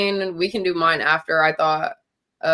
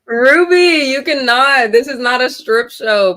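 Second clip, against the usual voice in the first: playful protest; 6 semitones higher, 6 dB louder, slower than usual. Real, not acted. Playful protest at friend changing.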